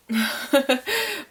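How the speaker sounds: short high laugh, falling at the end; high little burst of laughter. Real, not acted. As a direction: let out a brief real laugh. Laughs after tearful explanation.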